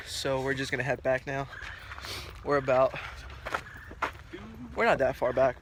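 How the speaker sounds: ordinary talk, nothing marked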